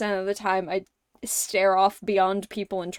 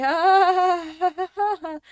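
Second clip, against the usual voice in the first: mock laugh; 8 semitones higher, 5 dB louder than usual. Mocking the friendly laugh.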